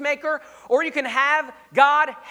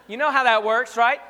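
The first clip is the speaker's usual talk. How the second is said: ordinary delivery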